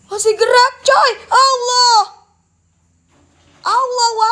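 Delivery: sudden high, loud panicked cry; startled scream of Allah; frightened shout. Real, not acted. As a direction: yelp out in real fright at something moving. Startled by moving object.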